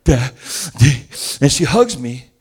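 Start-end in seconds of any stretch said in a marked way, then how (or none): 0.0-1.1 put-on louder, whimpering little girl's voice; tearful child. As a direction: whimper for daddy. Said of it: "she's going".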